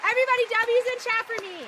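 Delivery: high, loud victory yelling; hyped-up, full-volume celebration; excited shouting. Real, not acted. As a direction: yell it triumphantly to the crowd. Celebrating with chat.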